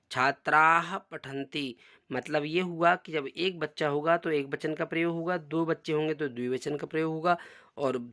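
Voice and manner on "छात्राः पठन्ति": slow, careful Sanskrit recitation. Shown as a demonstration. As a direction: spell out each syllable slowly for pupils. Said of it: "उसके नीचे तीन बच्चे बैठे हुए हैं". (धीमा संस्कृत पाठ)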